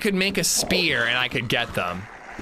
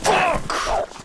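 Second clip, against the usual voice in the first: frustrated yelp. Real, not acted. Setback in game.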